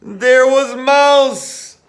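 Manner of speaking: high, loud and slow gasp of discovery; surprised discovery. A narrator's reading. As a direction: reveal the mouse with a big jolt.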